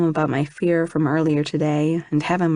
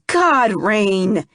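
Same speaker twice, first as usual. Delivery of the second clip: mild shout; 4 semitones higher, 3 dB louder than usual. A narrator's reading. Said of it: "he shouts".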